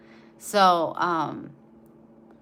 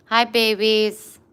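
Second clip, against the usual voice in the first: cooing greeting; 2 semitones higher, 8 dB louder, slower than usual. Real, not acted. Greeting puppies.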